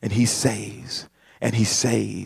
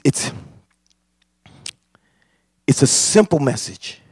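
normal talking voice

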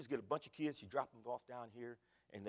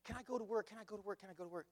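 high, quick repeated begging; pleading kid; 6 semitones higher, faster than usual. Retold or imitated. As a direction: pester your parents eagerly in a higher pitch. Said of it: "I begged them for about six months".

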